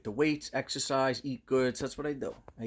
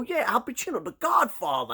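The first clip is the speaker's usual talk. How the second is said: high, loud retold exasperation; flipping out; 13 semitones higher, 5 dB louder than usual. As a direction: insist excitedly, voice pitched up. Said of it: "I'm flipping out".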